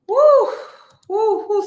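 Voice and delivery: shocked high whoop; loud high woo. Real, not acted. Reacting to the threats, loud high woo. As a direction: let out a loud stunned woo.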